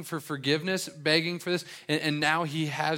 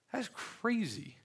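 high, slow, softer "That's crazy", in disbelief; amazed aside; 8 semitones higher, 7 dB quieter, slower than usual. Real, not acted. Pastor marvelling at the king's forgiveness, high and slow in disbelief. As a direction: let it float up, stunned by the mercy.

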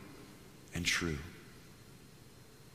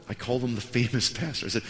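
ordinary delivery

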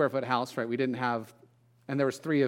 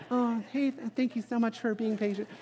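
higher, softer, sugary politeness to the guests; sweet hostess voice; 8 semitones higher, 4 dB quieter than usual. Retold or imitated. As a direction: switch instantly to charm for the party. Mom sweetly turns to guests.

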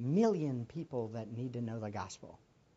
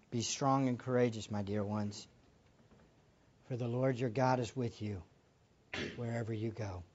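tender blessing; 4 semitones lower than usual. Real, not acted. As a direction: bless them gently. Blessing over missionaries.

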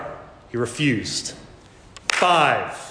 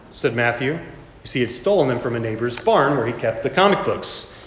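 normal talking voice

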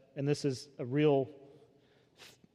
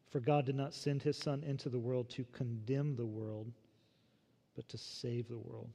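hushed reverent reading; 3 semitones lower, 10 dB quieter than usual. Acted. Reading verse seventeen reverently.

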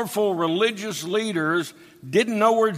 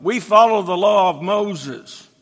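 angry reply; 2 semitones higher, 5 dB louder than usual. Retold or imitated. Pharisees angrily answering the blind man, loud retort. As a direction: bark it back in defiance. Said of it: "they angrily replied".

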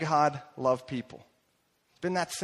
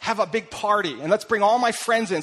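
high, eager invitation, louder at the end; excited invitation; 5 semitones higher, 4 dB louder than usual. Retold or imitated. New follower inviting Jesus to a party, excited. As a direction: imitate an excited host rallying friends. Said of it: "he's so excited".

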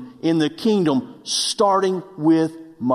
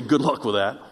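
laughing retort; 6 semitones lower than usual. Retold or imitated. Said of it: "first I'm gonna say".

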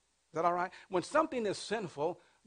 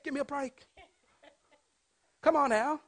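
usual speaking voice

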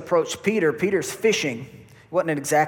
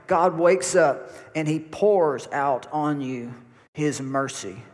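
ordinary talk

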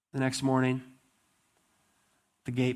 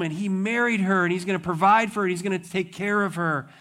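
gossiping admirer; 7 semitones higher, 5 dB louder than usual. Retold or imitated. Townsfolk gossip about the king.

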